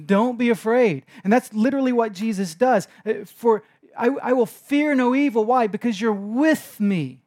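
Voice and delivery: loud, high, insistent preaching; Jesus urging his frightened disciples, preaching loud. Acted. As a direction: plead with the crowd to trust. Preaching at full force.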